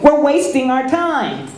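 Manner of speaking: put-on whiny restless farm boy; young Luke. A narrator's reading. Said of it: "Luke said".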